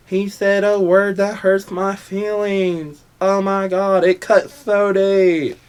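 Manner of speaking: high, slow, drawn-out mock whining; hammed-up wounded voice, pitched high; mock oversensitive complainer. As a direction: imitate someone with badly hurt feelings. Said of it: "That's what you guys sound like".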